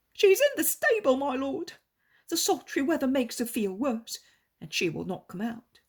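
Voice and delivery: high, loud, desperate begging; pleading innkeeper. Acted. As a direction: beg the Lord on your knees for your child. Innkeeper answers the Lord.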